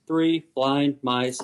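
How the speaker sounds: very slow, deliberate three blind mice; slow rhythmic cadence. Shown as a demonstration. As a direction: stretch each word out slowly like a tune. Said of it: "the song Three Blind Mice".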